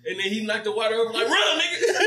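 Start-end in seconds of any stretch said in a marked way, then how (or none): 1.3-2.1 high laughing shout of run; laughing yell. Retold or imitated. Retelling the skit, yelling to flee, laughing shout. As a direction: yell it through laughter, mock panic.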